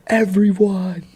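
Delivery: very high, slow drawn-out shout; mock pleading yell. [name] begs the fighting friends, high slow mock yell. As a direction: stretch it out like a desperate plea.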